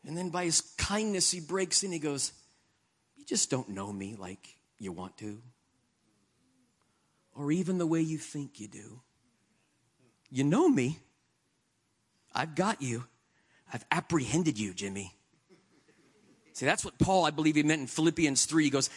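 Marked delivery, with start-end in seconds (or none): 3.2-15.2 gentle voice of God. Acted. God speaking tenderly to him.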